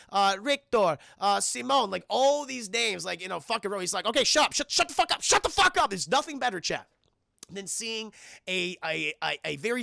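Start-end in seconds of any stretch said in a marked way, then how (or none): 4.0-5.9 angry yell. Retold or imitated. Annoyed shouting at girl.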